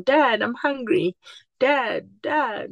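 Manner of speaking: put-on a hungry kid calling for dad, pitch up; child calling dad. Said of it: "kids running to daddy and saying".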